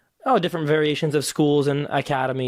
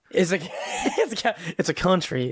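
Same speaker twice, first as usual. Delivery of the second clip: laughing through the words, pitch up; breaking into a real laugh mid-sentence; 4 semitones higher than usual. Real, not acted. Guest laughing at the cowboy accent remark, laughing.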